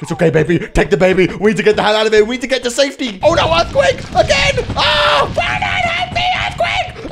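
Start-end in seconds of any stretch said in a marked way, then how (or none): 3.2-7.1 high frantic yelling; panicked screaming. Real, not acted. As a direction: scream in alarm. Second earthquake.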